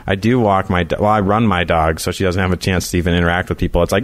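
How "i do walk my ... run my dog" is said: ordinary talk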